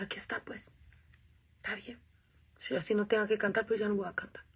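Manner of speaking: hushed whisper of giving up; whispered surrender. Retold or imitated. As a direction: give in softly, almost no voice.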